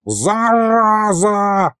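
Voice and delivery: high, slow drawn-out yell; ghost yelling. Acted. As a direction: howl it at her. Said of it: "завопил вдруг лиховит".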